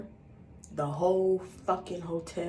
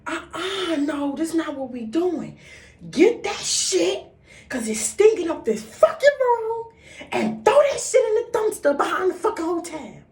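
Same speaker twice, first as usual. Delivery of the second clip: furious mother; 11 semitones higher, 7 dB louder than usual. Retold or imitated. Mother ordering daughter to dumpster, high furious yell. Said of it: "she looked at me like".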